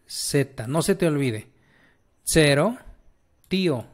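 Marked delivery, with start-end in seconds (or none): 2.2-2.8 Cero as tsero, rising; Italian ts sound. Shown as a demonstration. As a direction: model Cero with an initial ts. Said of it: "la vas a pronunciar como T".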